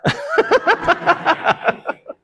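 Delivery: high airy laugh at the memory; breathy unvoiced laugh. Real, not acted. Preacher laughing after the story of his mother, unvoiced laugh.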